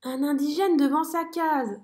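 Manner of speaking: cri aigu puis souffle émerveillé; s'émerveiller de tout. A narrator's reading. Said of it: "s'écrie Zigomar". (merle émerveillé)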